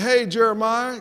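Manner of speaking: put-on high, loud country-accented greeting. Property manager greeting a tenant on the phone, country accent. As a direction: call out with a country twang. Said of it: "got a country accent".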